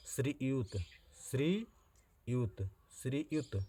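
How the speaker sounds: श्रीयुत drawn out slowly and high, syllable by syllable; careful spelling-out. Shown as a demonstration. Teacher to exam students, slow careful spelling pronunciation. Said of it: "श्रीयुत नहीं होता".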